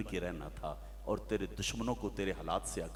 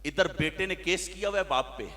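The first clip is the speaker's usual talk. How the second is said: rising higher and louder as he goes; mounting preaching; 11 semitones higher, 10 dB louder than usual. Acted. Preacher listing the ills of society, building up. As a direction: build the rant from telling to crying out.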